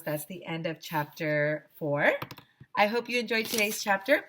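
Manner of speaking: plain reading voice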